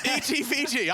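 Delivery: put-on high, drawn Aussie accent. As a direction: another name in an Aussie accent. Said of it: "It got worse".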